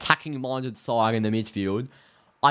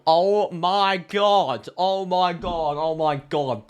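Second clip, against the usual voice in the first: drawn-out awe; 7 semitones higher, 5 dB louder, much slower than usual. Real, not acted. Dreaming of Germany versus Brazil.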